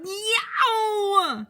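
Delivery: high, loud cry of tooth pain; loud, high yelping child in pain; pained yell. Acted. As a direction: wail for Mommy like a hurting kid. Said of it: "and yelled".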